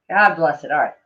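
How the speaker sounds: usual speaking voice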